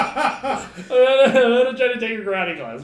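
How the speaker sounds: slow words bubbling up through a laugh. Real, not acted. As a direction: talk through leftover laughter, high. Laughing while admitting karate plan.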